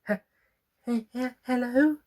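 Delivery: high, halting, shy stammer; timid stammer. Acted. Frightened little plant stammering its first hello. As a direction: stumble over the hello, nervous.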